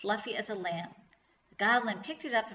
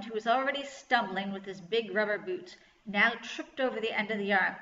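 usual speaking voice

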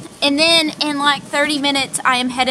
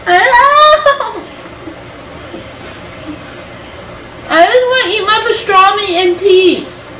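exasperated pet talk; 8 semitones higher than usual. Real, not acted. Exasperated at pets crowding.

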